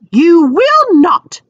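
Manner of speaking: slow, loud snapped whisper. A narrator's reading. Mrs Weasley snapping at Fred, slow and hushed. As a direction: bite it off, hushed and sharp. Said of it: "snapped Missus Weasley".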